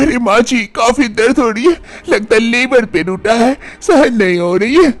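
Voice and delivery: put-on high-pitched young wife, strained with pain. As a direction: complain of labor pain, pitched well up. Manisha in labor pain.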